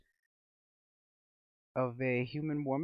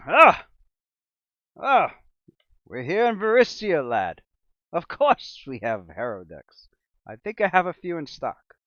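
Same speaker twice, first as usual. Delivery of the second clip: higher, louder boisterous merchant; hearty shopkeeper; 3 semitones higher, 9 dB louder than usual. Acted. Shopkeeper to customer asking for harrow decks, loud hearty. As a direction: burst out like a jolly storekeeper.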